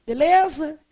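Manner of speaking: usual speaking voice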